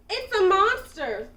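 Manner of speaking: high, loud cry for help; loud terrified yelp; frightened scream. A narrator's reading. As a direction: scream in sudden panic. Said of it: "he screamed".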